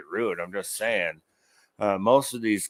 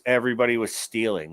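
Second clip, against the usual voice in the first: normal talking voice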